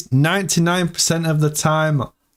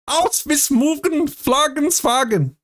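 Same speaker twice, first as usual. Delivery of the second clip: a hammed-up fake German, much higher; mock German; 10 semitones higher than usual. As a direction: pretend to speak German with gibberish. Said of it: "I think that's German".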